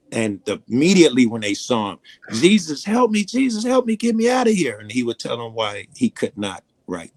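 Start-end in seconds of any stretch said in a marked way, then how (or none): 2.3-4.8 higher, begging voice of the damned; mild pleading cry. Retold or imitated. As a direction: plead desperately for rescue, a touch hammed. Damned souls beg Jesus.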